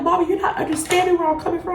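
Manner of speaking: fast, high retold protest; pleading back. Acted quarrel with mother.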